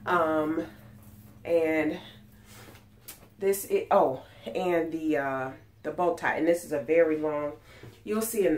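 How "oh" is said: usual speaking voice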